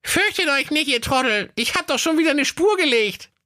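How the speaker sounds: put-on high squeaky little boy voice; a bratty kid bossing his siblings; cheeky little boy. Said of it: "sprach zu seinen Brüdern".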